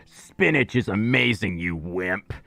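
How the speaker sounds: low, teasing put-on friend voice; Grox impression. Streamer imitating a viewer, teasing in a low voice. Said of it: "I'm pretty sure Grox talks like this".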